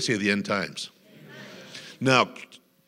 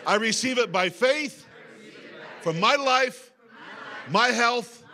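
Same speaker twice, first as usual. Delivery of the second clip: preaching at full force; 12 semitones higher, slower than usual. Acted. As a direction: proclaim it to the whole church.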